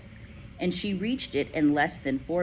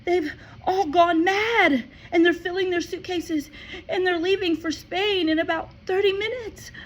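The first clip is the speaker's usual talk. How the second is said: put-on distressed girl; 6 dB louder than usual. A narrator's reading. Matilda tells Miss Honey, near tears. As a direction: blurt it out breathless. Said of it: "she cried".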